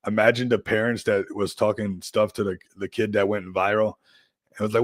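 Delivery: ordinary delivery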